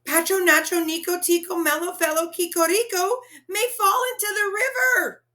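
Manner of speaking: high, loud rush building to a cry; panicked run of names; urgent blurted name. Acted. Boy blurts the long name again.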